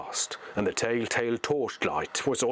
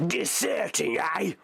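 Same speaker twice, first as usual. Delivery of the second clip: higher sneering orc voice; orc snarl; 8 semitones higher, slower than usual. Acted. Orc slave driver accuses deserters, high sneering snarl. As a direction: catch them out with a sneer. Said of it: "he snarled".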